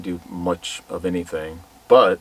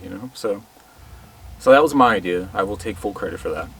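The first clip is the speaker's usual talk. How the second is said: ordinary talk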